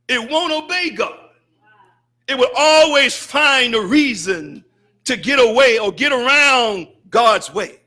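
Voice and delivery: normal talking voice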